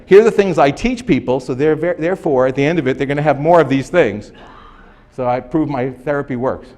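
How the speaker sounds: loud, lightly acted therapist's pitch; mock self-assured therapist. Lecturer imitating therapists defending their method, mildly self-assured.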